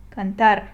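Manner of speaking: cantar with firm stress on TAR; last syllable stressed. Shown as a demonstration. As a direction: punch the end of cantar. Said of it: "you stress the last syllable".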